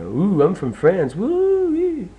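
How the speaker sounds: cheerful mock Frenchman whooping; mocking French person. Mocking a French person met online, hammed-up whoops. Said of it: "a French person online".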